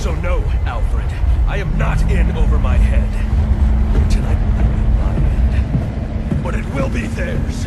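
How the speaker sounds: defiant yelling that builds to its peak; angry shout. Acted. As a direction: hammer each claim home, furious. Bruce shouts down his butler.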